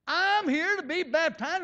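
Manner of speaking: loud, high and slow, every word pushed out; loud declaration. Retold or imitated. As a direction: announce it to the whole church as loud as you can. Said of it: "I said as loud as I could".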